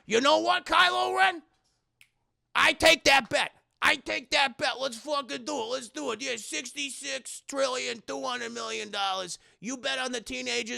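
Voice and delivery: rival gambler. Retold or imitated. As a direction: accept the bet with swagger. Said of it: "And he's like".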